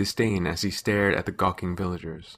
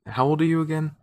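higher-pitched, probing question; questioning aside; 7 semitones higher than usual. A narrator's reading. Narrator breaks off to check listener's age, questioning.

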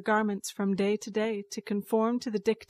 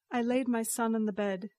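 usual speaking voice

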